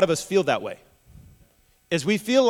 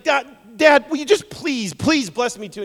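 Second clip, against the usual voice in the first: high, raised-voice desperate appeal; pleading; 7 semitones higher, 6 dB louder than usual. Acted. Esau begging Dad for any blessing, pleading. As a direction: beg Dad, pitch jumping up. Acted Esau begs his father.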